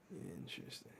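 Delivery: normal talking voice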